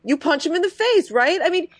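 like it's the only logic there is; mock Chad. Imitating Chad justifying punching people. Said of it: "He's like".

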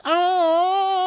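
very high, drawn-out put-on coo; mock gushing "oh". Imitating her reaction to the Care Bear story, gushing.

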